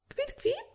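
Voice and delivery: high, far-off chirping tweet; bird chirp. A narrator's reading. Swallow calling down to Thumbelina from above, a high chirp.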